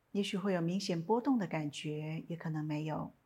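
calm, unhurried meditation guidance with long pauses; guided meditation. Acted. Teacher guiding listeners lying down in a body scan, calm meditation voice.

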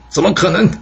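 high-pitched indignant outburst; narrated enemy's angry command; angry scolding. A narrator's reading. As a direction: curse in anger, voice jumping up. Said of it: "窦月娇生气地大骂说".

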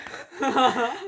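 just laugh out loud; amused laugh; laughter. Real, not acted. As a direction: burst of real laughter. Laughing at the story's end.

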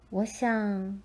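plain reading voice

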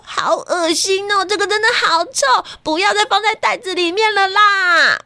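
put-on high disgusted kid whine; whine, grossed out; whiny child. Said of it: "雅丽都说".

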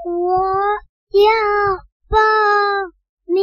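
each word stretched apart; slow sloth voice. Acted. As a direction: drag every syllable like a sloth. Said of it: "树懒说".

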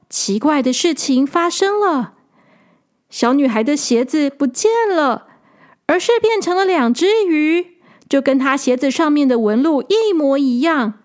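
plain reading voice

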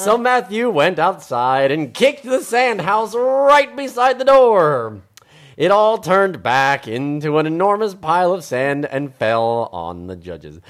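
loud, slow, animated read-aloud; dramatic story narration. A narrator's reading. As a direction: build the crash for young listeners. Reads story climax aloud.

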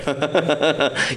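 short chuckling burst; laugh. Real, not acted. Joking about ghosts exercising, short real laugh. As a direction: laugh briefly at your own joke.

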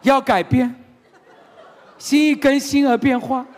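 loud, high sermonizing at dad with laughter; hammed-up preachy son. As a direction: lecture your father like a pulpit preacher. Youth lecturing father with scripture.

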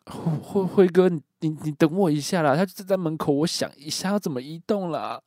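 frightened stuttering plea; nervous. Retold or imitated. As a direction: imitate a panicked friend stalling. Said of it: "小雨紧张的回答我".

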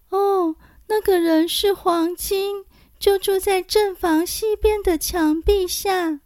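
thin high little voice. A narrator's reading. Xiyao voiced by narrator.

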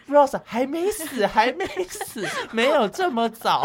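high, slow, amused director voice. Pretend. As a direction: play the director, half laughing. Voicing the director's thoughts.

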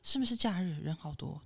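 whispered and soft, imitating the driver. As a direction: hush it like a mumbling driver. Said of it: "很小声". (小声耳语)

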